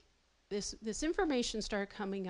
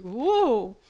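a loud, alarmed whoa; startled whoa; 11 dB louder than usual. Retold or imitated. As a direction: jolt back as he comes at you. Father lunging from chair.

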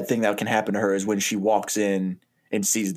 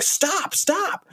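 very high mock-panicked plea; panicked protest. Craig fending off ex-wife in the bathroom, high panicked protest. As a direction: fend someone off, voice shooting up. Man fends off his ex.

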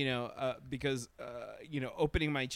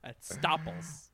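mock German accent; 3 semitones higher, slower than usual. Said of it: "I'm doing a German accent".

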